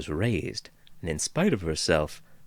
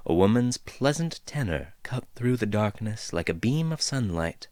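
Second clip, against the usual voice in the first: plain reading voice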